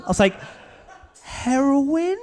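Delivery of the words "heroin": high, unsure laughing question; laughing guess. Retold or imitated. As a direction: ask it half laughing.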